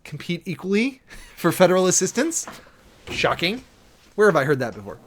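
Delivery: loud, laughing sarcasm; sarcastic. Real, not acted. Commentator mocks the familiar clause with a sarcastic laugh.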